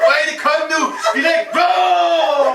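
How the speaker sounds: mock shout building to a long held last word; high, loud and drawn out at the end; cri du collègue. Collègue imité, conclut en criant. (cri moqueur, aigu, qui s'étire à la fin)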